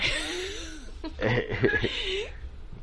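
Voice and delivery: high burst of laughter. Real, not acted.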